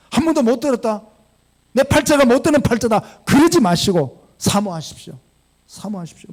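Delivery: fervent preacher appeal; urgent exhortation. Acted. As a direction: press them hard to long for it. Urges congregation to long for God.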